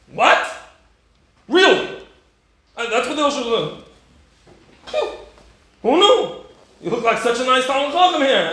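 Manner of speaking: high, loud incredulity; hammed-up astonished disbelief; mock shock. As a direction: react as if scandalized by the confession. Acts shocked listener to the doubter.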